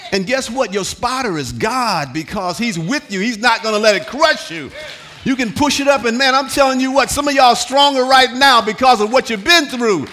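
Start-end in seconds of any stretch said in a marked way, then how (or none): 5.2-10.1 loud, driving preacher's climb; full-force pulpit preaching. Acted. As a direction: preach it home with fire. Preaching climax.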